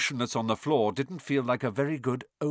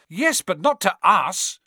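exasperated; 8 semitones higher, 6 dB louder than usual. A narrator's reading. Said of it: "said Ron in exasperation".

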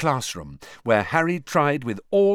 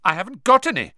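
quick, high protest; blurted out; 9 semitones higher, 7 dB louder, much faster than usual. Acted. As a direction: blurt it before thinking. Harry blurting, loud high.

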